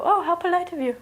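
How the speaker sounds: high, pleased politeness; smiling approval. Acted. Other people praise the excuse, smiling and approving. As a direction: coo approvingly with a smile. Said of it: "They just smile and go".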